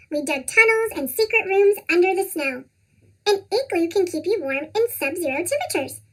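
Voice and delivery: plain reading voice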